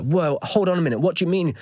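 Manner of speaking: retold startled objection; disbelieving protest. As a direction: challenge it in alarmed disbelief. Alarmed new father challenges the midwife.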